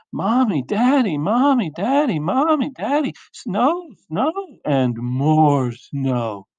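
high, sing-song calling out; excited child's yell. Acted. Olivia calling her parents to see snow, excited high yell. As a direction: call for the parents in high rising swoops. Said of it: "ran into the kitchen and yelled".

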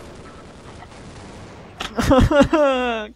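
high laugh rising in volume; exasperated laugh. Real, not acted. Player reacting to another mishap, high exasperated laugh. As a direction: laugh helplessly at your own failure.

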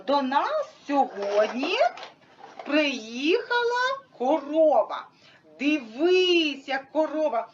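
slow, sing-song game announcement; drawn-out, playful teacher voice; playing with a child. Shown as a demonstration. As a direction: present the cow to a small child, stretching words. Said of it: "Можна грати в таку гру, наприклад".

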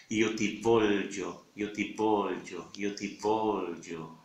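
io ti voglio said slowly, stressing voglio; slow, careful voglio with the gli marked; slow Italian phrase. Shown as a demonstration. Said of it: "como decir yo te quiero". (io ti voglio despacio, acento en voglio)

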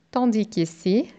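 normal talking voice

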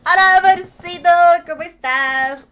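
booming hello to a viewer; loud cheerful greeting. Real, not acted. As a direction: belt the hello across the room.

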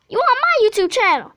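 loud, high excited yell; excited shout. Real, not acted. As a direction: shout it out, thrilled. Repeating reveal to friend.

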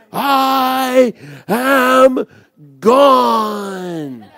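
loud, high, drawn-out proclamation; yelling. Retold or imitated. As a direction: belt each word out and stretch it. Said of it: "like, she's yelling".

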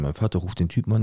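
usual speaking voice

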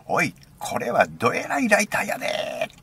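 high, loud Osaka-dialect exclamation; Osaka dialect shout. Retold or imitated. Imitating friend amazed by the lighter, Osaka dialect shout. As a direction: holler it in broad Osaka dialect. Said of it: "大阪弁で".

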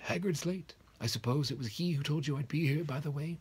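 kindly headmaster, pitched high and a bit loud. A narrator's reading. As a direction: chide her fondly, slowing on nonsense. Dumbledore checks his watch.

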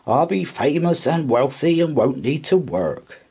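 high, smug boasting to himself; smirking. A narrator's reading. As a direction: revel in coming riches with a smirk. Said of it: "he thought with a smirk".

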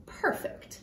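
sly whispered aside. Acted. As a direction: breathe it out in a scheming whisper. Bear pleased with scheme.

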